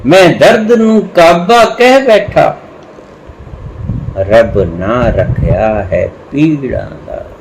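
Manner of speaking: slow verse, opening high and settling down; slow Punjabi couplet with stretched words; poetry recitation. Acted. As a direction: declaim the first line high, then ease into the second.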